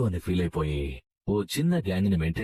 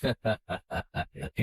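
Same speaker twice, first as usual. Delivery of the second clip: mocking villain laugh; 4 semitones higher than usual. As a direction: laugh off his plea. Villain scoffs at the plea.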